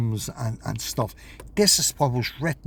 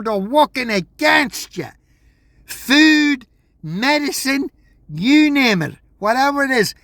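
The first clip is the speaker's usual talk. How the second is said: loud, raised rant, pitch climbing higher; a real heated outburst, pushing every line louder; worked up; 8 semitones higher, 5 dB louder than usual. Real, not acted. Ranting at his viewers that everyone works against them, heated and loud. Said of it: "I'm getting a bit worked up there".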